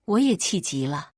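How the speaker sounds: ordinary delivery, nothing marked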